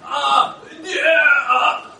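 high, loud, laughing stammer; flustered Peter. Acted. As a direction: blurt it out like a panicked fisherman. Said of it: "gonna imagine that's Peter".

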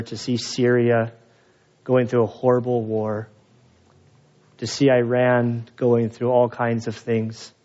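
closing prayer. Acted.

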